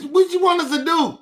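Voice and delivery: exasperated. Real, not acted. Exasperated plea to voters.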